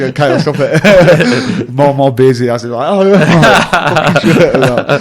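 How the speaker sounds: loud, high, laughing retelling; laughing exasperation. Retold or imitated. Player recalling his starstruck brother's antics, laughing loudly. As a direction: crack up while telling it.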